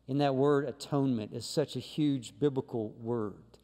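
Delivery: ordinary delivery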